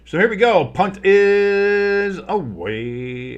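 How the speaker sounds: loud, high announcer call with a long stretch; big play-by-play call. Real, not acted. Announcer calling the punt to listeners, big excited call. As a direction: sing out the kick, stretching it high.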